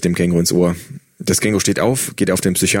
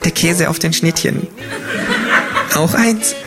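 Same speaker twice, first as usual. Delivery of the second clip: innocent; 10 semitones higher than usual. A narrator's reading. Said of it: "mit Unschuldsmiene".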